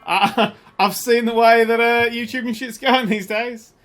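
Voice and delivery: South African accent. Shown as a demonstration. Streamer trying a requested accent, slow South African accent. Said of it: "South African accent?".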